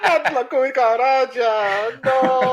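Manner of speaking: high, slow comic whine; silly high voice. Pretend. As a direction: squeak it out, hammed-up.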